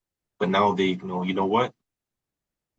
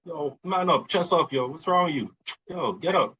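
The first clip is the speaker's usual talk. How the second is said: mock tough-guy scolding; 9 semitones higher than usual. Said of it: "everyone's like".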